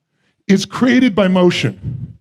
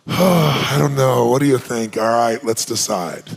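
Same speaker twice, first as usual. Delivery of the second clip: much lower, slow and draggy indecision; listless and unmotivated; 9 semitones lower, slower than usual. Retold or imitated. Mocking someone who decides without passion, low and listless. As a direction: droop into a limp "I don't know" and barely get through it. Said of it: "when you're like".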